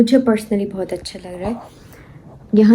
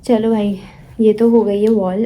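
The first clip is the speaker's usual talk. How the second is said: ordinary delivery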